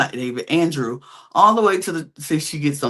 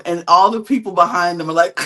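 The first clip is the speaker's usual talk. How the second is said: ordinary talk, nothing marked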